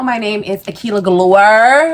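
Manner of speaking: ordinary delivery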